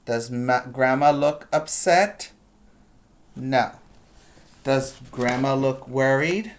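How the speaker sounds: ordinary delivery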